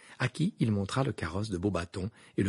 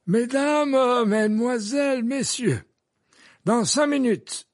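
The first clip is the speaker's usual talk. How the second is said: much higher, louder, slow ringing address; formal announcement; 13 semitones higher, 5 dB louder than usual. A narrator's reading. Dumbledore addressing the school, ringing and slow. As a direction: announce it to the whole hall.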